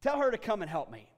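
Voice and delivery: loud, high, exasperated whining; shrill-free but loud, put-upon protest; exasperated complaint. Retold or imitated. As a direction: play Martha complaining, loud and high, to Jesus about her sister. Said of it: "she came to Jesus and she said".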